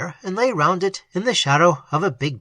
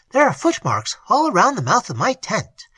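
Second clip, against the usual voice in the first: higher, louder, eager voice; excited; 6 semitones higher, 3 dB louder than usual. A narrator's reading. Higher excited report. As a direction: Sangree reporting the tracks to the doctor, excited. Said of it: "he said with excitement".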